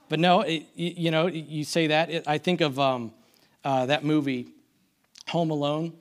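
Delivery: ordinary talk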